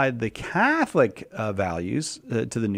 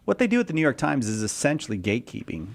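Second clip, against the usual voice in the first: normal talking voice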